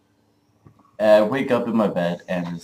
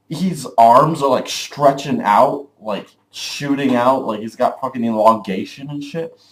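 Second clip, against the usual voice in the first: ordinary talk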